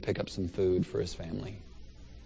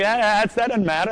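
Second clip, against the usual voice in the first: higher and louder, quick mock objection; flustered protest; 10 semitones higher, 6 dB louder than usual. Protesting to Bob that the pants don't count, flustered. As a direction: brush it off, a bit flustered.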